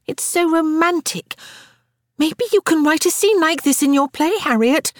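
put-on very high, swooning little girl; gushing. A narrator's reading. Little Frances urges Harriet to write the scene, gushing. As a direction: gush it with starry-eyed delight. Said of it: "Frances gushed".

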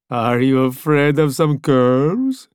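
slow, mocking driver voice; taunting Italian voice. A narrator's reading. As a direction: tease him about the curves.

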